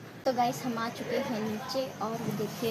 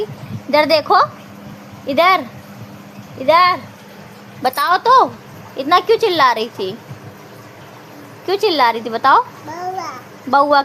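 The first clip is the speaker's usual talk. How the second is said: loud coaxing call; 8 dB louder than usual. Real, not acted. Mother loudly coaxing her toddler to look, playful calls.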